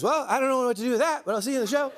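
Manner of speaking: high-pitched flustered host impression; Jimmy. Comedian retelling the host's baffled reply, high-pitched impression. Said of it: "Sounds like me".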